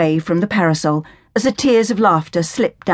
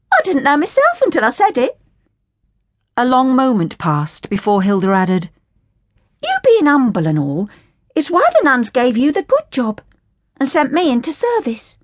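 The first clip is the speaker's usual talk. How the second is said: put-on higher, scornful working-class girl voice; indignant Cockney orphan girl; 7 semitones higher than usual. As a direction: sneer at the Sally Army in a higher, cheeky voice. Hilda voices her grievance.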